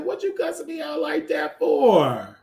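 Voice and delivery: high, shouted indignation; indignant retort. Retold or imitated. Host retelling how she answered the man who cussed her out, high and indignant.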